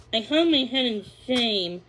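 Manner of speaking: plain reading voice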